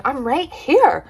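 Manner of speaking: high, upset protest; mock offended whine; hammed-up indignation; indignant. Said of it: "She was like".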